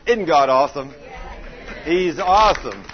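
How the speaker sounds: loud, ringing praise; exultant praise. Real, not acted. Preacher exults loudly to the congregation. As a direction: lift the crowd with it.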